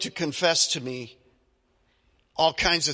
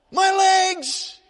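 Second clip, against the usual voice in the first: high, shouted cry of my chest; high shouted ah, ah, loud as a man in pain; loud screaming; 14 semitones higher, 7 dB louder, much slower than usual. Retold or imitated. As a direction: yell it out like he's in agony. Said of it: "he is that loud or louder".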